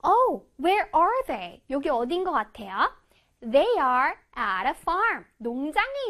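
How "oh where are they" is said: playful wonder. Shown as a demonstration. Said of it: "teacher asks about the picture".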